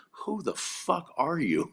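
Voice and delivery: slow, high disbelief; stunned friend. Retold or imitated. Friend stunned after the stadium hug, slow amazed. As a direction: drag it out, amazed at who he's with. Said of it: "he goes".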